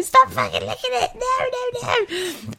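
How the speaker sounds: laughing at the dogs. Retold or imitated. Telling her dogs to stop licking, high laughing shriek.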